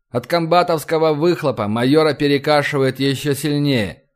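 ordinary delivery, nothing marked

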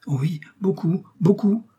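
high, breath-light eager confirmation. A narrator's reading. Novice confirming she knows Athos well, eager.

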